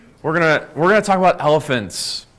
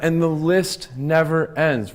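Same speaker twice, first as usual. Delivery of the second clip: the list never ends, drawn out slowly; slow and weighty; slower than usual. Real, not acted. Coach telling a small team audience, slow and deliberate. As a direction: let never ends land with a falling close. Repeats the phrase for emphasis.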